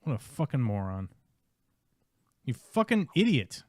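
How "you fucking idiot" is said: high, loud angry shout; furious yell. Real, not acted. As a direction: yell the insult in real rage. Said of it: "I screamed at somebody".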